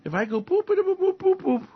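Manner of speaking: high playful button-press boops; boop noises. Retold or imitated. Trump pressing the nuclear button, playful high boops. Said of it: "He goes".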